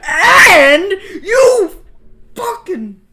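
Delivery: high, very loud shriek; losing it completely, screeching; enraged screaming. Real, not acted. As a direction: scream in outrage, stretching the words. Player explodes at the ending.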